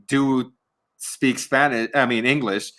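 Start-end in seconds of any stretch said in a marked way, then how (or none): none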